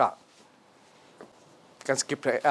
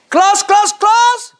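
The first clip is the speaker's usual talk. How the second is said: loud call; 13 semitones higher, 11 dB louder, much slower than usual. Acted. Teacher attention call-and-response.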